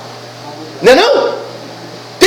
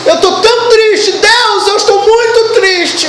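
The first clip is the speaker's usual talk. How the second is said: lightly hammed-up sad complaint, higher; mock whiny believer; 8 semitones higher than usual. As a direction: parody a self-pitying prayer. Preacher mimics a sad believer.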